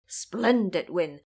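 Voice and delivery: usual speaking voice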